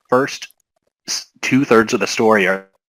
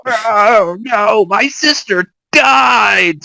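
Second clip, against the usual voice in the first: mock tragic; 12 semitones higher, much slower than usual. Mocking tonal whiplash in game.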